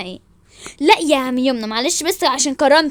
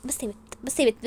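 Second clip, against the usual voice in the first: softer, dismissive telling-off; dismissive hushing; 3 semitones lower, 9 dB quieter, faster than usual. Acted. Wife tells rival to stop, softly dismissive. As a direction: brush her off under the voice.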